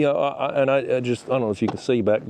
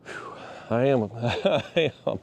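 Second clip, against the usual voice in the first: out of breath; 4 dB quieter, slower than usual. Real, not acted. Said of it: "I'm out of breath".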